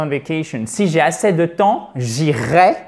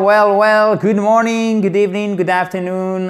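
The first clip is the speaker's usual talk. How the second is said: showy greeting; 5 semitones higher, 4 dB louder than usual. Acted. Showman's opening greeting.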